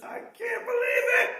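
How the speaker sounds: high shout of disbelief; thrilled Zacchaeus shouting in disbelief; excited. Acted. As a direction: burst out in amazement. Zacchaeus thrilled Jesus spoke.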